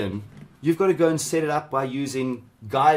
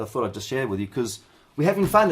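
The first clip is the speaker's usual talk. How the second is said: ordinary talk, nothing marked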